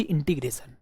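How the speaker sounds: ordinary talk, nothing marked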